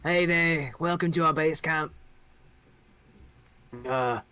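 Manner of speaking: a friendly king who took something; young king. Acted. King welcoming the adventurers, high and loose.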